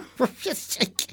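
high impish giggle; goblin giggle. Acted. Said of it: "the goblin suddenly said".

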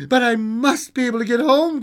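put-on high, loud little-girl protest; acted childlike distress; anxious cry. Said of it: "cried the little girl".

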